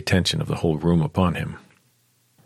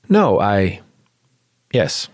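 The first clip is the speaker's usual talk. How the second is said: faltering; managed; 3 semitones higher, 5 dB louder, much slower than usual. Acted. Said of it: "she managed".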